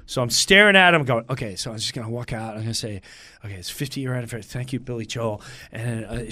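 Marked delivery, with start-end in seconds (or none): 1.3-5.4 low and soft, to yourself; hushed private rehearsal; nervous self-rehearsal. Retold or imitated. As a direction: run lines under your breath. Nervously rehearsing his speech to himself.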